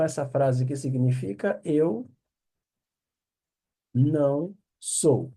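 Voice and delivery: normal talking voice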